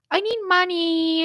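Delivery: put-on high child's plea; little niece voice. Niece asking her aunt for money, high child voice. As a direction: ask like a small girl who wants something.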